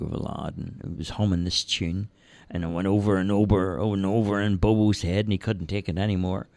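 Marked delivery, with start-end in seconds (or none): none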